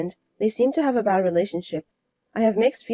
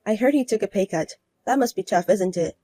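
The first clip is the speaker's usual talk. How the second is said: ordinary talk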